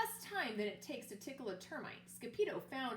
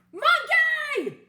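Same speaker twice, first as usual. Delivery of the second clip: much higher, far louder shriek; yell; 18 dB louder than usual. Acted. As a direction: scream out the name of your lost toy. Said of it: "yelled Scapito".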